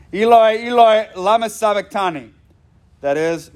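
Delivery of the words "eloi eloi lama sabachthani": raised, higher anguished cry of Eloi; loud cry. A narrator's reading. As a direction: call it out higher and louder, in anguish. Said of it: "cried out with a loud voice".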